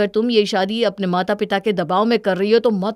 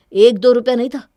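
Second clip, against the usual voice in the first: angry demand; 4 semitones higher, 8 dB louder than usual. Acted. Husband angrily demanding missing money.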